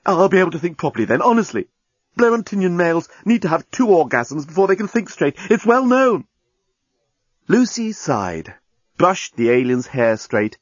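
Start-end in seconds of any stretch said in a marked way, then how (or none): 0.1-6.2 higher-pitched, insistent wheedling; alien journalist, earnest pleading. Acted. Alien persuading Lucy, earnest and insistent. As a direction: argue your case eagerly, rising in pitch.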